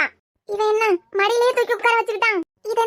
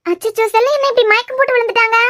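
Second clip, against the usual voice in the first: alarmed calling; 6 semitones higher than usual. Acted. Woman finding the fainted girl calls out in panic. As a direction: call her name in a panic as she lies fainted.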